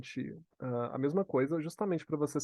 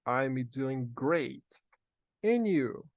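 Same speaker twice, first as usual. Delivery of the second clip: spaced out for learners to repeat; modelo para repetição; much slower than usual. Shown as a demonstration. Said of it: "Repete comigo". (bem devagar, para o aluno repetir)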